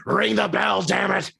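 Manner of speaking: high-pitched, hammed-up yell; mock angry demand. Wrestler demanding the bell be rung, mock angry yell.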